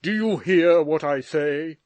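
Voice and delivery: father shouting at his son. A narrator's reading. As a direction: roar him out of the room. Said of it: "he shouted out".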